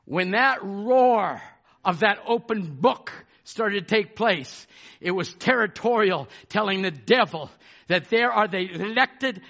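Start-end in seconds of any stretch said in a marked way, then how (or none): none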